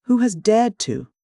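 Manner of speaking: high, slowed indignant outburst; indignant exclaim. A narrator's reading. Beadle to the upset matron, indignant and pompous. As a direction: bristle with offended dignity. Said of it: "exclaimed Mister Bumble".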